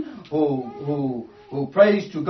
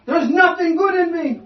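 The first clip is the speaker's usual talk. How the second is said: much higher pleading cry; crying out for mercy; 9 semitones higher, 4 dB louder than usual. Retold or imitated. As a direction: plead it up high in confession. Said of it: "And he's crying out for mercy".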